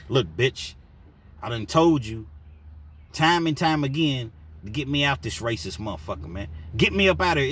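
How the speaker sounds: building from firm to a loud, high yell; angry letter read out. Retold or imitated. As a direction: read the letter as if you're fed up and cussing. Reading Omar's angry letter aloud.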